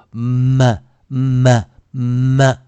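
么 drawn out as "mmm-muh"; clear dark M. Shown as a demonstration. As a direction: hum into each 么, release on schwa. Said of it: "为了让同学们听得更清楚".

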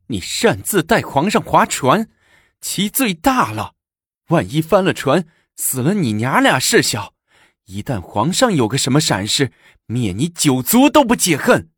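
high-pitched, indignant accusation; imperious threat. A narrator's reading. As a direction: pronounce the crime with mounting menace. Empress threatens, pointing at consort. (盛气凌人地高声调问罪)

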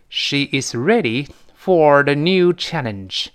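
slow, evenly spaced dictation-style English; deliberate word-by-word example reading; slow model sentence. Shown as a demonstration. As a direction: read it out carefully for learners. Teacher models example sentence slowly.

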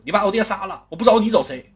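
high, loud furious comeback; angry retort. Acted. Wounded son yelling at his father's killer. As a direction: throw the accusation back at him.

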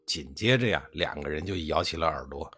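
ordinary delivery

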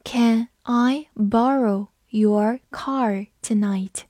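slow, deliberate sentence reading. Shown as a demonstration. Teacher reading the sentence slowly. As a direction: read it slowly for learners. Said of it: "把后一句也读一下".

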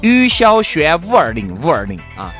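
ordinary talk

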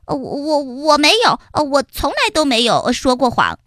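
nervous stutter, then a rush; stammering lie. Acted. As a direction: stumble through the lie. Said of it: "说起话来结结巴巴的". (黑黑结结巴巴地撒谎)

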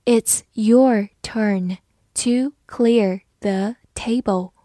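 slow and even, each word apart; slow reading. Shown as a demonstration. Teacher reads slowly for the class, slow reading. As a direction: read it slowly for learners. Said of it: "好，慢慢来读".

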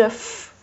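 demonstrated lip-teeth friction on "F"; fricative F sound. Shown as a demonstration. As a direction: sound out "F" as just the consonant, no vowel. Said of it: "上牙咬下唇有个摩擦音出来".

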